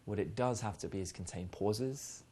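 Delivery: hushed, unhurried seduction tone; seductive. Shown as a demonstration. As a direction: speak softly with a knowing smile, as if sharing an unspoken joke. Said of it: "Seductive conversation sounds exactly like".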